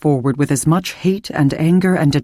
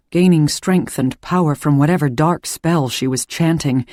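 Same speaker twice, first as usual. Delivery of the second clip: ordinary delivery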